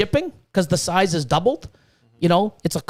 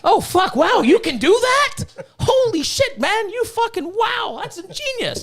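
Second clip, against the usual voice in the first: high, hammed-up mock awe with a laugh; sarcastic amazement; 11 semitones higher than usual. Mocking the cohost who bought another rack, high sarcastic awe. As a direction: pretend to be dazzled by the obvious.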